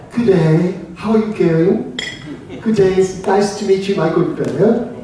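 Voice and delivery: mock-formal English accent, two separate words; overly proper English greeting, evenly spaced; wrong, over-proper G'day. Shown as a demonstration. Showing newcomers how not to greet, mock-proper English accent. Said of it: "It's not".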